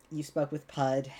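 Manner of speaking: ordinary delivery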